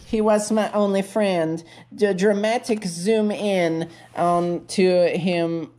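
normal talking voice

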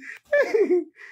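short high burst of laughter. Real, not acted. Woman laughs at the joke, short laugh.

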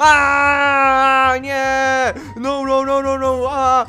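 loud anguished no no no; horrified scream. Acted. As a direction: scream in horror. Meets the girl he fears.

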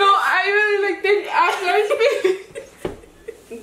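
high voice broken by laughter; giggling through the words; laughing. Real, not acted. Laughing at himself.

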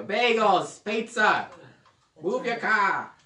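loud, high hammed-up New York accent. Shown as a demonstration. Preacher doing a New York accent that makes Amber laugh. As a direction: bark it like a cartoon New Yorker. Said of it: "Amber likes the accent".

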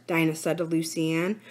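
ordinary delivery

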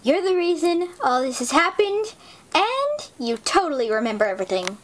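ordinary talk, nothing marked

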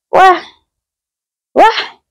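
high amazed exclamations, pitch shooting way up; amazed wah. Real, not acted. Touring her friend's room, amazed by the massager, high wah. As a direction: gasp out your amazement.

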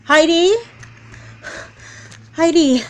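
high, loud calls of her name; urgent calling. Acted. Mom rousing Heidi from a nightmare, calling loudly. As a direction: wake her up fast, alarmed.

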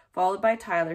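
usual speaking voice

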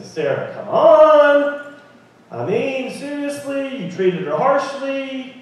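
high, exasperated "come on"; chiding Sarah. Pretend. Imagined talk to Sarah, high mock scolding. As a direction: scold her, half laughing.

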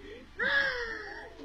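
high excited gasp with a laugh; delighted gasp. Acted.

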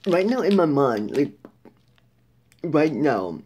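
talking between bites; chewing while talking. Real, not acted. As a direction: mouth partly full of food. Seen 3: Bites bar at -5, lips closed and chewing while speaking after.

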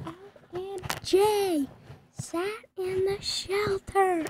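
put-on high, slow girly voice; high drawn-out voice. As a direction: stretch it out high. Reading correction in put-on voice.

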